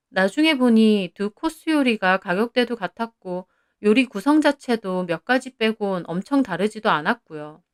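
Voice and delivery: ordinary delivery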